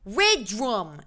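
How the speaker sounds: unplaceable botched British accent; failed British accent. Shown as a demonstration. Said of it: "I don't know what that was".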